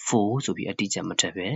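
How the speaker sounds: ordinary delivery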